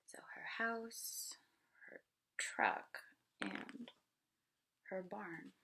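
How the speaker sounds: breathy whispered rereading. Shown as a demonstration. Teacher repeats the page to the class, whispered. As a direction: hush it like a secret. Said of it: "rereads the page as a whisper".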